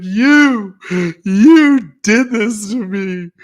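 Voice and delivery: high, loud, slow crying wail; crying wife. Retold or imitated. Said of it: "She's like".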